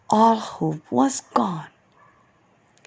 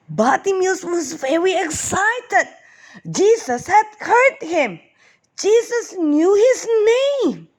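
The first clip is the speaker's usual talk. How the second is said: breathless excitement; 10 semitones higher than usual. A narrator's reading. Narrator shares his excitement.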